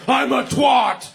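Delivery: slow, high, loud outburst in a daughter's voice; mock shout. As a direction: imitate a child suddenly yelling, words stretched. Daughter's sudden mood flip, acted out.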